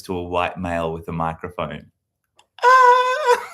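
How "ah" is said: mock startled shriek. Mock surprise at prepared clip.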